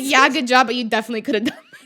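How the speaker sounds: loud, quick mock backhanded praise; teasing friend. Joey giving backhanded praise, loud and quick. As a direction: needle her playfully. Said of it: "He was like".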